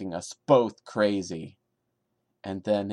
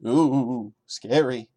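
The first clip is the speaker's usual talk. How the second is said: sarcastic mock fear; 2 semitones higher, 5 dB louder, slower than usual. Mocking the creepy text.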